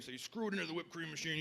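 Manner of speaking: ordinary talk, nothing marked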